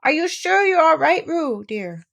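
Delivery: a mother fretting at the bank; anxious call. Acted. Kanga runs along the bank calling worriedly. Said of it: "Kanga was jumping along the bank, saying".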